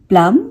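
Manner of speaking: प्लम said with no b at the end; silent b in plum. Shown as a demonstration. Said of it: "यहाँ पे हम बी को नहीं pronounce करेंगे". (प्लम, बी silent)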